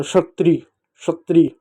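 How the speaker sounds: क्षत्रिय said "shatriy"; correct sh sound. Shown as a demonstration. Teacher drilling children, क्षत्रिय as "shatriy". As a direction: clear sh opening on क्षत्रिय. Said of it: "श जैसा इसका उच्चारण होता है".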